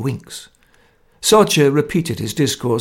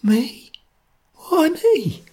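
faint frightened question; much slower than usual. A narrator's reading. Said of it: "asked faintly".

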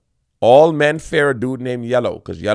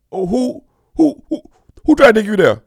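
a hint of a prickly man's voice, rising; mildly jealous man asking who; jealous ego; 8 semitones higher, 4 dB louder than usual. Man's ego hearing about a rival, mildly jealous. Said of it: "His ego could tell him".

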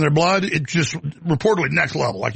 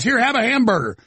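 higher, chummy offer of a burger; mock politician; 7 semitones higher than usual. Politician on tape offering voters a hamburger, mock chummy. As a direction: play a stiff politician faking friendliness. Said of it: "Put 'em on buns".

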